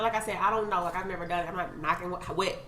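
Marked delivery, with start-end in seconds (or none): none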